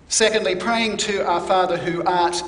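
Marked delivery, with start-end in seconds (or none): none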